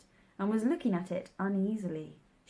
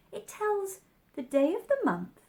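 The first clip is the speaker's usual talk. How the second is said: Alice voice; 12 semitones higher than usual. A narrator's reading.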